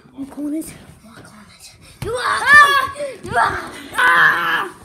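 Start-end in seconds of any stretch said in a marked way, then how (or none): 0.6-1.4 whispered corner; whispering. Real, not acted. Same words whispered. 2.2-4.8 loud frightened screams; screaming. Real, not acted. Cornered, screaming.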